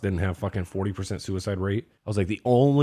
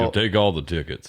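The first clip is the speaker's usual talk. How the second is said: Sam Elliott; 3 semitones lower than usual. Retold or imitated. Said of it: "It's like Sam Elliott".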